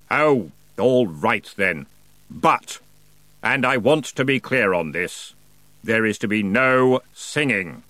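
slow, lightly voiced reluctant ferryman; a hint of a weary boatman giving in; grudging ferryman. A narrator's reading. Ferryman grudgingly agrees, slow mild grumble.

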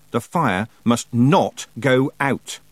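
slow, with NOT hit hard; loud stress on NOT. A narrator's reading. Girl orders the fire kept burning, NOT stressed loudly. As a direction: set NOT apart and punch it loudly. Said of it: "not was loud and frightening".

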